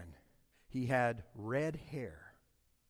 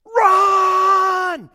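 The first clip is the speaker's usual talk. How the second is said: sudden full-throated scream, much higher; coach screams; 20 dB louder than usual. Retold or imitated. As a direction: bellow it like a furious coach on the sideline. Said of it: "He screams".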